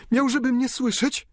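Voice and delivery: high, loud hopeful cry; cried out. A narrator's reading. Countess cries out to her dying father, high and loud. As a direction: cry out as if he might still hear. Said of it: "krzyknęła".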